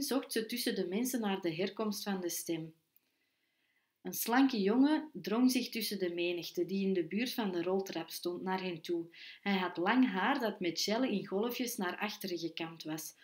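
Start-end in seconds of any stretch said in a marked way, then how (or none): none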